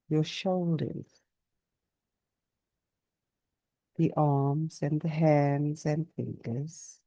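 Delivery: unhurried yoga nidra voice with long pauses; guided meditation. Real, not acted. Teacher guiding listeners to notice body and breath, calm meditation. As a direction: guide them gently through the body, leaving space after each phrase.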